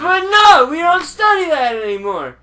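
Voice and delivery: high, loud know-it-all rant; loud mock lecturing. Real, not acted. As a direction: yell it like a smug expert. Shouting back in the argument.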